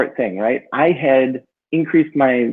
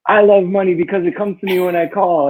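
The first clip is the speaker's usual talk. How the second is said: higher, mock-earnest mantra chant; full on mantra voice; 6 semitones higher than usual. Driving alone, reciting a money mantra, mock-earnest chant. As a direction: recite it like a proud affirmation. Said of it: "So I would say".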